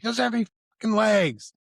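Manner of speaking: very high, fast mock yell; exasperated retold outburst.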